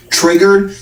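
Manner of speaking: high, rising mock question again; taunting high question; mocking taunt repeated.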